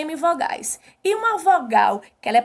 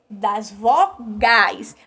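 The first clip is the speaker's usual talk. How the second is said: "vogais" slow and split, vo... gais; low, drawn-out "Das vogais" in separate beats; syllable by syllable; 4 semitones lower, much slower than usual. Shown as a demonstration. Teacher answers own question emphatically.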